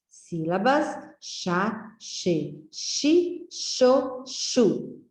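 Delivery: each syllable said slowly and separately: chá, che, chi, cho, chu; syllables sounded out. Shown as a demonstration. Teacher sounding out syllables for children, slow and separate. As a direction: sound out the syllables one by one for the class. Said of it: "Nós vamos aprender hoje o som das".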